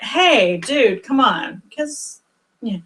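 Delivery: ordinary delivery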